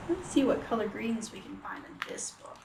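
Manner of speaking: quick hushed whisper. Real, not acted. Reader invites children to look, whispered.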